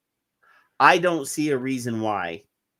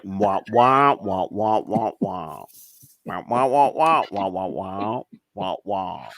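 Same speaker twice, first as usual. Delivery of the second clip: low, measured muted-trombone wah-wah; low wah-wahs, not chicken-like; Peanuts schoolroom voice; 4 semitones lower, slower than usual. Shown as a demonstration. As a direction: demonstrate the cartoon teacher's wordless drone. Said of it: "It was more like".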